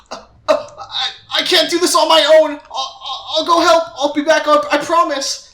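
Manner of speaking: high, loud, stammering mock panic; freaking out. Trapped Soda's rescuer promises to return, acted panic. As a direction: imitate a panicked man babbling promises as he bolts. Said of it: "He's freaking out. He's like".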